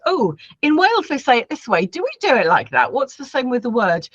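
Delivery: a hint of an inquisitive pupil; voiced classroom questions. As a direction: pitch up a little as if wondering aloud. Voicing pupils' language comparison questions.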